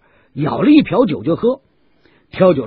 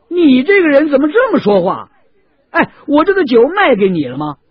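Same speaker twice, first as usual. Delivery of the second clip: louder, higher scornful challenge; sneering retort; 11 semitones higher, 7 dB louder than usual. Acted. 挑酒大汉冲着杨志不满地质问. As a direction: throw his words back at him. Seller argues with Yang Zhi.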